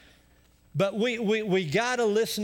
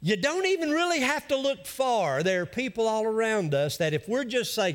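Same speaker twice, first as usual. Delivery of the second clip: ordinary delivery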